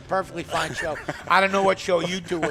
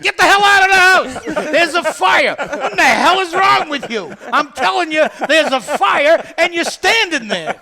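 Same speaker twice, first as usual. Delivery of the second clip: high, loud hammed-up alarm tirade; frantic mock yelling; 7 semitones higher, 6 dB louder than usual. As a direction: yell like an exasperated smoke detector. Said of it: "it should be like".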